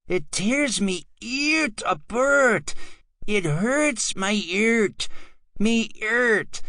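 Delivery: high, slow wailing lament; mock heartbroken wail. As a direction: cry out in exaggerated grief.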